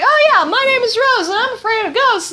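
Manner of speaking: high, bubbly girlish impression of Rose; mock Rose voice. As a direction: squeak it out like a naive game heroine. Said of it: "and she's like".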